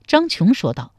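ordinary delivery, nothing marked

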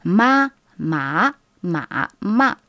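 ma said through level, rising, dipping and falling tones; four tones drill. Shown as a demonstration. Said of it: "une technique pour maîtriser les quatre tons".

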